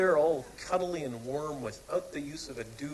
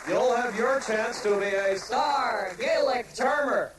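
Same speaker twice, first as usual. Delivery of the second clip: hyped host; 5 semitones higher, 6 dB louder than usual. Acted. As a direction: sell it like a game-show host. Host introduces star charmer.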